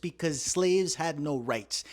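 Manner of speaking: ordinary talk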